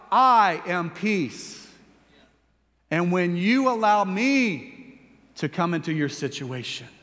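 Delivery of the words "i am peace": I am peace drawn out very slowly, each word weighted; slow declaration. Acted. God declares to the believer, slow and weighty, each word stretched. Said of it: "cries out".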